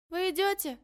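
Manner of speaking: put-on high distant call, girls up ahead; calling from afar. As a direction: shout it from a distance.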